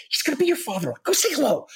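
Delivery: high-pitched imitation of a pushy wife; insistent urging. Wife pressing husband about future father-in-law, insistent urging. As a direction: mimic her insisting, pitch climbing.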